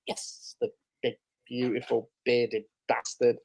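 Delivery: slow, half-whispered admiration; retold awed greeting, very soft and drawn out; hushed relish. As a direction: savour each word under your breath. Retells greeting friend arriving on stage.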